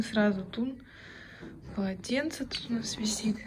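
normal talking voice

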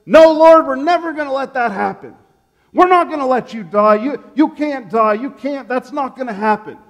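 loud, high, insistent protest; a scolding disciple refusing his master's fate; scolding rebuke. Acted. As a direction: refuse to let it happen, pushing back hard. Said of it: "he's scolding and trying to correct".

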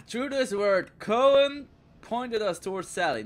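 loud, high, overblown trailer read; dramatic reading. Pretend. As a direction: overact it like a grand narrator. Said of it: "a dramatic impression".

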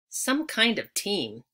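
ordinary delivery, nothing marked

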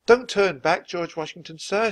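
put-on high, urgent girl's shout; acted shout, high and insistent. A narrator's reading. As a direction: call out a plea at high pitch. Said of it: "she shouted".

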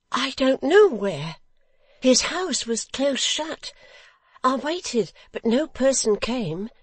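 high, loud, slow innocent; high, excitable simple son; Barnaby, eager simpleton. A narrator's reading. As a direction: protest and boast in a high childlike voice.

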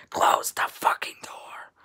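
harsh, forceful mock demand with little voice; imitating sister's attitude. Sister orders sibling to close the door, with attitude. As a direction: mimic a sister snapping an order. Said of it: "asked me with an attitude".

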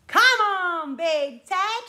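loud, high, drawn-out summons; loudest voice call. Pretend. Calling Big Ted with the children, loudly. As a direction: call the teddy out with full voice. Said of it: "in our loudest voices".

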